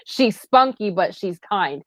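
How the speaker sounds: ordinary delivery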